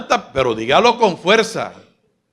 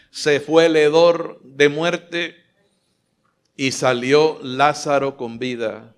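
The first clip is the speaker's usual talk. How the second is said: ordinary talk, nothing marked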